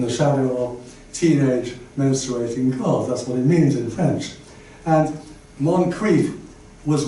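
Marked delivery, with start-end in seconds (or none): none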